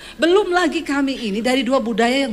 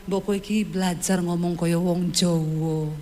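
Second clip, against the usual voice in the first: husband's advice; 6 semitones lower, 4 dB quieter than usual. Retold or imitated. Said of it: "Dia bilang".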